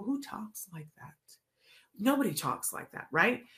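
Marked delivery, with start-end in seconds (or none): 0.0-1.1 whispered aside to herself; a breathy scoffing whisper; mock whisper. Teacher whispering her scorn to the listener. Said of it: "And I go".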